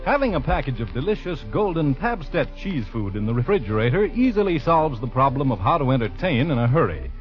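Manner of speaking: ordinary talk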